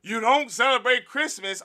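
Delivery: high, loud mock outrage; mocking a sellout. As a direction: act scandalised at him.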